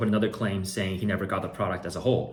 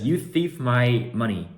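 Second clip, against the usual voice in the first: slow, louder mock accusation; reading buyer's accusation; 4 semitones higher, 6 dB louder, slower than usual. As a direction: drag out the buyer's complaint. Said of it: "he says".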